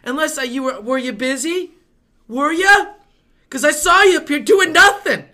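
high, loud, accusing questions; angry and sarcastic. Retold or imitated. Waiter retelling his rant at coworkers, angry and sarcastic. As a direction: needle them, building to a loud accusation. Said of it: "angry and sarcastic about it".

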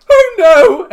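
very high, loud rabbit yelp. Acted. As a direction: cry out in cartoonish high-pitched alarm. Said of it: "and he's like".

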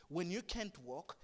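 normal talking voice

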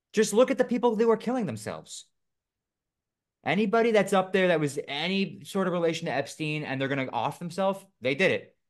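loud, high-pitched rant; worked up. Real, not acted. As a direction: get fired up about it. Worked-up rant about suicides.

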